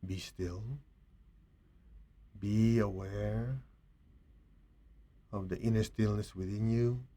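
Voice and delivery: hushed, unhurried blessing; guided meditation. Real, not acted. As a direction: gently guide them into stillness.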